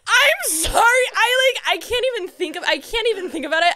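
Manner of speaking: high laughing disbelief. Real, not acted. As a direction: laugh through it, overwhelmed. Overwhelmed laughing after the punchline.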